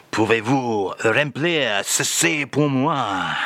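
a lightly done [name] with a French lilt, slowly; mock French [name]. [name] reads the French phrase to the host in a mock French accent. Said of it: "Today's French phrase again, [name]".

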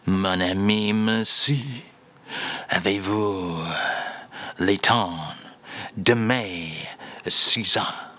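finest French filling. Acted. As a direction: lay the French on thick and slow. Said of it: "this time with your finest French filling".

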